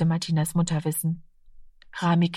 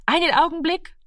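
high called-out reply; mother calling back, a touch raised; 10 semitones higher than usual. A narrator's reading. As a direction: call it back toward the door. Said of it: "rief die Mutter".

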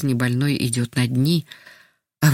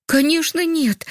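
put-on much higher, childlike, glad voice; delighted boy; 13 semitones higher, slower than usual. A narrator's reading. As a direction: answer as a pleased little boy. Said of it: "обрадовался мальчик". (радостный мальчишеский голос, высоко)

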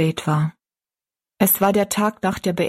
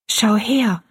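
higher, voiced stand-in for a whisper; confiding girl; 4 semitones higher than usual. A narrator's reading. Rosalie showing her friend something, confiding and higher. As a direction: share a secret in a raised girl's voice. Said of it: "in leisem Flüsterton".

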